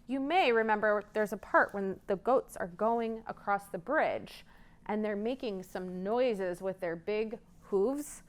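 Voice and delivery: usual speaking voice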